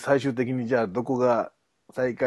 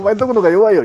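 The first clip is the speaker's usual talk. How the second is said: mock fan shouting; 9 semitones higher, 12 dB louder, faster than usual. Fans quarrel over who is worse.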